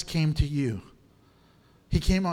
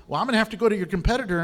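rushed customer; 2 semitones higher, much faster than usual. Retold or imitated. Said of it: "would call and say".

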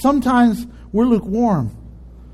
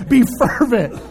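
ordinary delivery

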